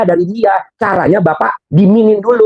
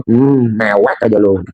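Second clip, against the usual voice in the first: mock Allah; 7 semitones lower, slower than usual. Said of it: "kata Allah".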